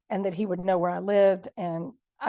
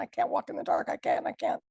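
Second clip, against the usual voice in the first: scared whisper; 6 semitones higher than usual. Retold or imitated. Panicked plea to security guard.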